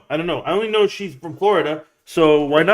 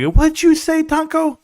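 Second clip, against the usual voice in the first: squeaky high voice, much higher; high mocking voice; 12 semitones higher, slower than usual. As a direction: pitch it way up, mocking. Imagined wife, high far voice.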